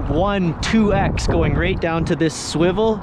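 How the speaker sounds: ordinary talk, nothing marked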